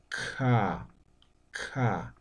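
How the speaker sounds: "ka" con la a alargada, suave y despacio; vocal larga. Shown as a demonstration. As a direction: estira la a de "ka". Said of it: "alargo la pronunciación de la vocal A". (mostrando la a con diéresis de "ka", alargada)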